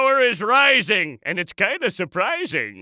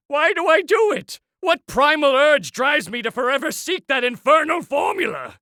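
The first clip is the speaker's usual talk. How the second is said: high-pitched, theatrical anguish; grandly exasperated soliloquy; melodramatic lament; 5 semitones higher than usual. Acted. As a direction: wail it like a tormented villain. Villain laments his obsession.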